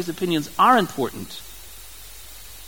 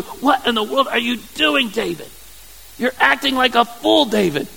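scolding wife; 6 semitones higher than usual. Retold or imitated. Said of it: "you can see how sarcastic she's gonna be".

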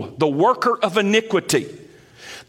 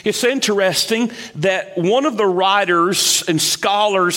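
usual speaking voice

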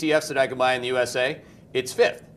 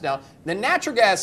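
normal talking voice

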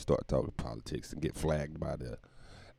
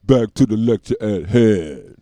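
[name] quote; 3 semitones higher, 10 dB louder, slower than usual. Retold or imitated. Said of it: "like Doctor Dre would say".

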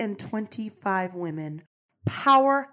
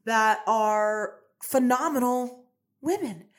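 ordinary delivery

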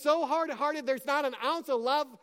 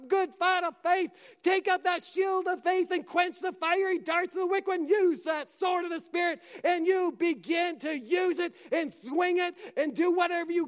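fervent preaching shout; 6 semitones higher than usual. Retold or imitated. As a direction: proclaim victory with fire. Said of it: "Jesus is saying".

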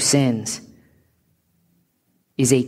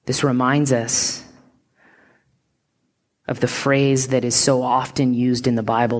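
ordinary talk

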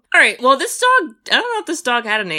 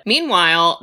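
ordinary delivery

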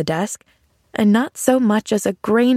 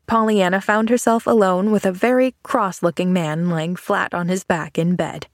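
plain reading voice

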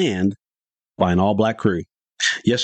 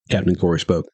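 ordinary delivery, nothing marked

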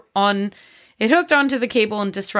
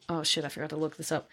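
usual speaking voice

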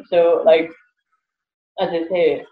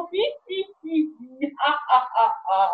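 high, soft, silly hee-hee giggle; mock giggle; 6 semitones higher, 6 dB quieter than usual. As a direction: giggle like a loon alone in the car. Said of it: "I'm going".

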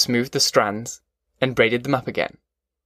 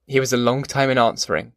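plain reading voice

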